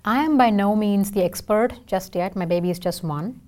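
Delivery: ordinary talk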